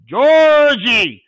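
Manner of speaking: loud, high, drawn-out call to a dog; long, inflected send. Shown as a demonstration. Handler sends Georgie on a long bird, long loud call. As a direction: send the dog far with a big stretched shout. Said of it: "that longer, more inflection drives them".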